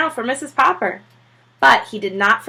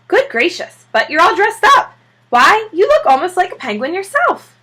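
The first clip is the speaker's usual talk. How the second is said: much higher, louder delighted surprise; wife startled by her dressed-up husband, delighted; surprised wife; 9 semitones higher, 4 dB louder than usual. Acted. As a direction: gasp it out, amazed at his suit.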